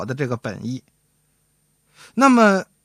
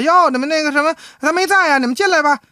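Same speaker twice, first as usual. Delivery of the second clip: high, louder housewife greeting; high-pitched wife's voice; wife at the door; 10 semitones higher, 4 dB louder, faster than usual. Acted. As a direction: play the wife letting visitors in, pitched well up. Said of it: "正好他媳妇儿开门了".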